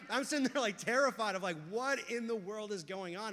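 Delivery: usual speaking voice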